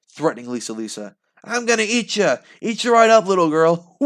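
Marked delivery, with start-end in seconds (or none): 1.4-4.0 high, loud hungry monster taunt; gleeful monster threat. Acted. Vampire taunting the little girl, high loud gloating threat. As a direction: gloat like a monster about to feast.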